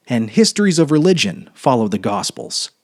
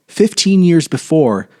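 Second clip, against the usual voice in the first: plain reading voice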